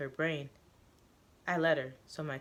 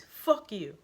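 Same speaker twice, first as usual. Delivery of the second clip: loud, higher furious scream; angry scream; 5 semitones higher, 6 dB louder than usual. Acted. Joseph screaming at the guard who shot him, angry yell. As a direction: hurl it at the guard. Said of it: "but he just screams".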